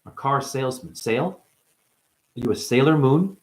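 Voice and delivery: ordinary delivery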